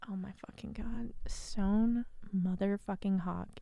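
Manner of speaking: slow breathless whisper of adoration; swooning whisper. Real, not acted. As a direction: whisper it like a prayer to him. Swooning over favourite character.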